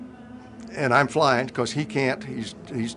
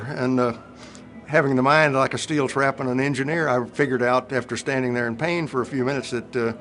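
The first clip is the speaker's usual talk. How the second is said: normal talking voice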